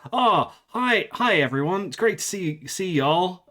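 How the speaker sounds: put-on bubbly young American shop worker; American accent.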